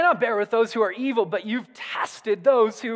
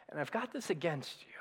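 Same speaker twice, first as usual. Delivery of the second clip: faster, breathy hushed rebuke; whisper; 6 semitones lower, 14 dB quieter, faster than usual. Retold or imitated. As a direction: drop to a whisper to deliver the blow. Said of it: "it's like Jesus saying".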